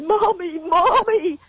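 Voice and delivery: high-pitched cry of "Mommy"; distraught child's cry. Retold or imitated. Teenage son rushing into mother's arms, imitated high-pitched wail.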